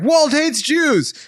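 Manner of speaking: high, slow mocking voice; mock whiny accuser. Mocking the fired guy's claim, high and slow. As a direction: drag it out in a high sneer.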